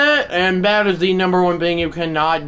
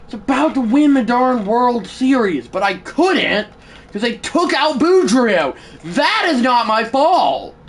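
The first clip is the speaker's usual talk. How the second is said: raised, aggrieved protest; furious; 3 semitones higher than usual. Real, not acted. Gamer denying blame for losing the World Series, furious. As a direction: protest your innocence, pitched up. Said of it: "I am furious right now".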